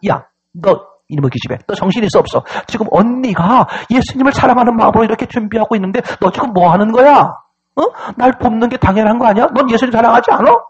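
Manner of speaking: big sister telling off the younger; mock-angry sisterly dressing-down; acted scolding.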